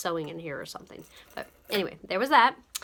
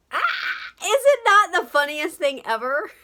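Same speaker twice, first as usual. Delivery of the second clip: excited; 11 semitones higher, 10 dB louder than usual. Real, not acted. Showing off the cactus ornament.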